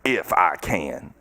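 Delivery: drawn-out, dropped low "if I can"; slow, sunk "if I can". Shown as a demonstration. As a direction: let "if I can" sink slowly, dismissive. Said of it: "slow low contrast take".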